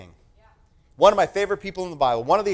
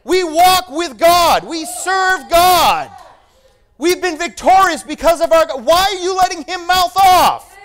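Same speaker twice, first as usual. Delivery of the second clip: shouted very high and loud, slowing on the God lines; rally a frightened army, outraged; indignant shout; 13 semitones higher, 10 dB louder than usual. Acted. David rebuking the cowering army.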